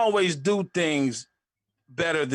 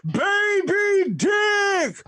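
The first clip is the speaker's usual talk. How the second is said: very high, loud drawn-out crowd shout; crowd roaring; 4 dB louder, much slower than usual. Retold or imitated. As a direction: roar like a crowd gone wild. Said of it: "the whole crowd's like".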